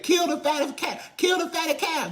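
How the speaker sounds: high loud celebratory shout; shouted command. Acted. Father's household ordering the feast, shouting high. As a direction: bellow it like a party order. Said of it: "They said".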